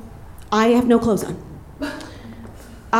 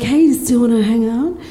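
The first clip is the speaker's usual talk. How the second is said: breathy flirty lady voice. Retold or imitated. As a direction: put on a seductive lady voice. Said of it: "that's my lady voice".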